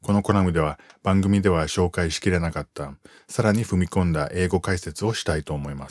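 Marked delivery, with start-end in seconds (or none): none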